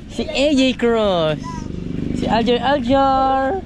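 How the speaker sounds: high, loud, slow call. Real, not acted. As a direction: shout a greeting across the yard. Calling out to a friend.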